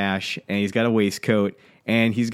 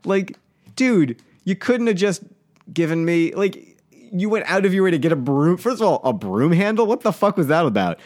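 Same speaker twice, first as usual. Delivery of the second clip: still pissed; 7 semitones higher than usual. Real, not acted. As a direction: vent at the guard as if he were here. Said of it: "I'm still really pissed about that".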